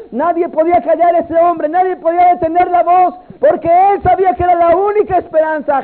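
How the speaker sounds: loud, high, driving sermon; full-force pulpit storytelling; fervent preaching. Acted. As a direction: preach it with rising fire.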